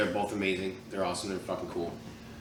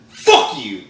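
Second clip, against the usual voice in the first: retold shout; 8 semitones higher, 8 dB louder than usual. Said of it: "and I said".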